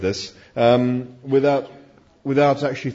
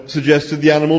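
ordinary delivery

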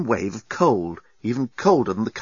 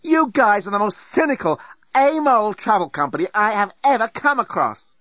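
higher, indignant voice; outraged; 9 semitones higher than usual. Acted. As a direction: tear into them, outraged. Lucy scolding the company.

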